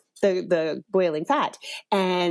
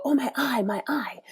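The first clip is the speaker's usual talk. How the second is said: pained whimper; 6 semitones higher than usual. Retold or imitated. Son hurt by yogurt splash.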